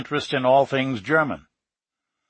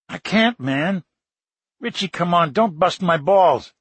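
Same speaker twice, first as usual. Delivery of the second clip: higher, whining don't push me; whiny protest; 5 semitones higher than usual. Acted. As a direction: whine at him to stop. Pleading friend not to push.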